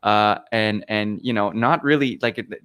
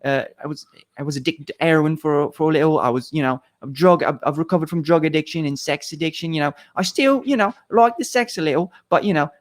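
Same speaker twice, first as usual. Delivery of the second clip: higher-pitched Cockney accent impression; Russell Brand; 5 semitones higher than usual. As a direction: imitate a rambling Cockney speaker, pitched up. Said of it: "his accent and everything".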